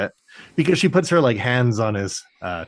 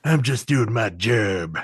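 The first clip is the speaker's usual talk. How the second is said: slow tough-guy growl; mock gruff hero line; gruff action hero; slower than usual. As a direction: play a stoic action star, drawn out. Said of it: "talks like a gruff action hero".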